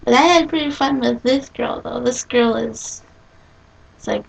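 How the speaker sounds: normal talking voice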